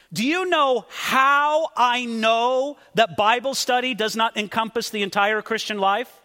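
normal talking voice

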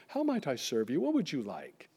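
lightly voiced, softly spoken waiter. Waiter asking how he might serve, lightly put on. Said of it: "Maybe like a waiter with the towel".

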